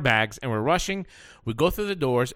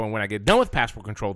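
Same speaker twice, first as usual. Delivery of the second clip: ordinary talk, nothing marked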